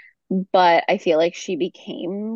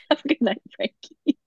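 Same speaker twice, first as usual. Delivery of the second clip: mock echo; 3 semitones higher than usual. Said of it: "friend echoes the line".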